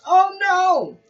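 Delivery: high, loud oh no; cat in hammed-up panic; mock alarm. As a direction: cry out as she falls on you. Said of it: "The cat says".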